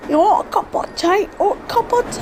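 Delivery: a cartoon Brit offering tea; mock British accent. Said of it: "stop offending every British person".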